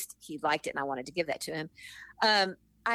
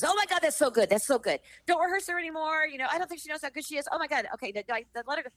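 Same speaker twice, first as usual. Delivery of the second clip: gushing praise; 8 semitones higher, faster than usual. Retold or imitated. Hopkins gushing over her rehearsal.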